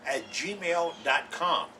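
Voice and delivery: very slow, syllable by syllable; real slow. Shown as a demonstration. Host repeating the email address, very slowly. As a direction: drag out the address for dictation. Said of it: "I gotta say it real slow".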